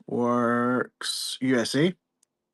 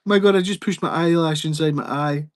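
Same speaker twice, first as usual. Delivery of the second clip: ordinary talk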